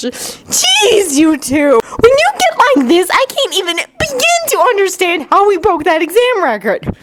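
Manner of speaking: high-pitched, fed-up telling-off; shrill-edged exasperation; exasperated scolding. Acted. Sakura scolds the two boys.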